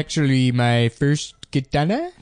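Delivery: put-on hesitant nerd in a made-up accent; mock a video host with a weird invented accent; odd accent, fumbling through the presentation; That Sodding Gamer. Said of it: "this weird accent he made up".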